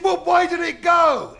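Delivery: high, slow shout; shouting back. Acted. Fella shouts after the geezer, loud and slow. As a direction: yell the question up the road.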